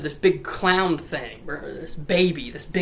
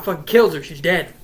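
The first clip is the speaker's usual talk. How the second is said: ordinary talk, nothing marked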